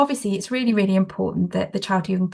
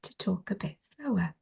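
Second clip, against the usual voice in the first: hushed, near-whispered slowing down; slower, calming voice; 9 dB quieter than usual. Shown as a demonstration. Trainer showing how she talks to children, slower hushed voice. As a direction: bring the voice right down, unhurried. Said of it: "I use my voice quite a lot".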